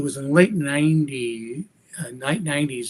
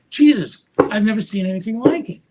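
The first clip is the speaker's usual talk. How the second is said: high, loud burst of retold astonishment; amazed; 6 semitones higher, 4 dB louder than usual. Magician reacting to an incredible routine, amazed. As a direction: exclaim it, stunned and raised in pitch.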